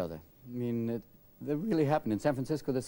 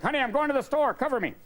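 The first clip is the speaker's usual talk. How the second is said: mock armed husband; 11 semitones higher than usual. Husband telling his wife he is going out, high and casual. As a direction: toss it off like an ordinary errand.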